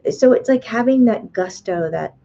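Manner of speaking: normal talking voice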